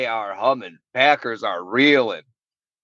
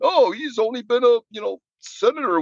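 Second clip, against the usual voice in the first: mocking critics; 9 semitones higher than usual. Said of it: "or when Obama got president".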